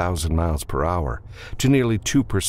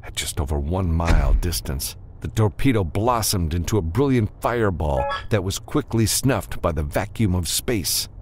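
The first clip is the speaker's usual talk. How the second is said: usual speaking voice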